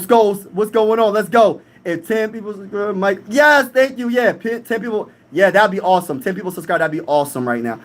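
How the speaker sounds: normal talking voice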